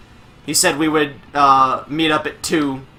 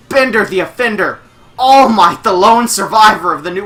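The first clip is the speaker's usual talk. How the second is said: ring announcer; 5 semitones higher, 6 dB louder than usual. Acted. Countdown then announcing the entrants.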